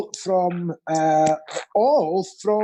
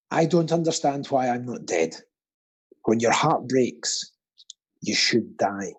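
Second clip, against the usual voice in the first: mock-gloomy recital; 7 semitones lower than usual. Quizmaster reading the ironically jolly quote, low and mournful. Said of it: "the, the jolly one".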